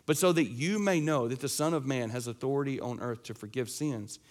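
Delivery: normal talking voice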